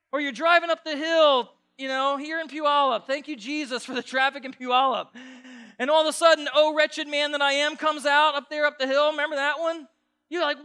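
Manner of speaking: high, loud, fired-up preacher; full-force preaching. Real, not acted.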